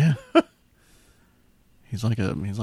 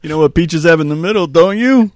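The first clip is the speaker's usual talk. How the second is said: much higher, louder goofy character; silly cartoon voice; 13 semitones higher, 13 dB louder than usual. Joking about Johnny Peach in a goofy high voice.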